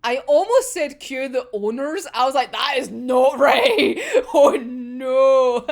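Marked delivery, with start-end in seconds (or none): 2.5-5.7 loud, drawn-out laughing dismay; real laughter. Real, not acted. Speaker caught saying the wrong word, laughing dismay. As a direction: crack up loudly over your own blunder.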